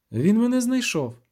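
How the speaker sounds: a touch of straining panic; groaning with effort. A narrator's reading. Sorceress tells him the djinn found her, mildly strained. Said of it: "Вона застогнала, червона від зусилля".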